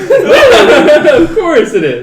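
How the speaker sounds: laughing in disbelief; cracking up. Real, not acted. Laughing at a lucky roll.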